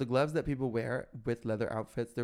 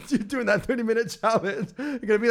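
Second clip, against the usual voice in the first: high, loud, laughing; laughing tease; 10 semitones higher, 6 dB louder than usual. Real, not acted. Teasing cohost, laughing.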